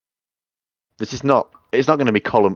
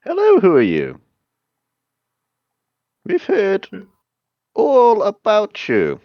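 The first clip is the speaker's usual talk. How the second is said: high, slow, sinister greeting; villain greeting; 12 semitones higher, 4 dB louder than usual. Acted. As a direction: welcome him like prey.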